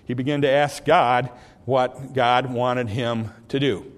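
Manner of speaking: normal talking voice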